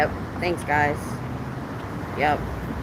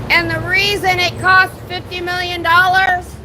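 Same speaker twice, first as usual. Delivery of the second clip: drill sergeant voice. Real, not acted. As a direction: shout it out to the building. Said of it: "I'm using my drill sergeant voice".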